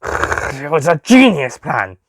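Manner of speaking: slow, offended protest; growling protest. Acted. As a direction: insist it was brilliant. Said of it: "he protested".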